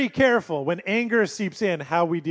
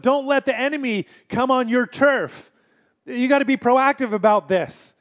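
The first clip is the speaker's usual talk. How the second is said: preaching; 6 semitones higher, 4 dB louder than usual. Acted. Preaching at full force.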